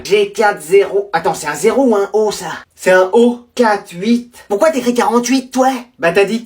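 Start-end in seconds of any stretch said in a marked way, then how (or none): none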